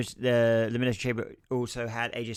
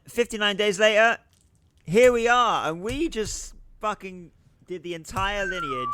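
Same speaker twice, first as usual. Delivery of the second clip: high and loud, exultant; triumphant; 9 semitones higher, 4 dB louder than usual. Real, not acted. Host celebrating finishing the title history, triumphant. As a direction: announce the finish line in triumph.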